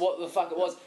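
normal talking voice

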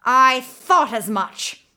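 loud, slow triumphant cry; witch crying out. Acted. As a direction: catch him out, loud and slow. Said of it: "the witch cried".

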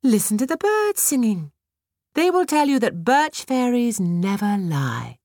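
bright high fairy coaxing. A narrator's reading. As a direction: enchant her gently. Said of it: "As the fairy spoke".

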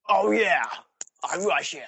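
high, loud mock realisation; Snake. Disguised spy remembering his cover, loud mock realisation. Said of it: "imagine if Metal Gear Solid dressed up as Rykov".

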